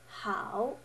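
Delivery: "how" dipping then rising; third tone. Shown as a demonstration. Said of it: "The third one".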